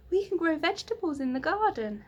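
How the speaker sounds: put-on child voice. Child Beth voiced in story.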